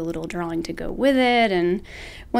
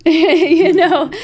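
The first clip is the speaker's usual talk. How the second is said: laughing; 9 semitones higher, 11 dB louder than usual. Real, not acted. Laughing at own success.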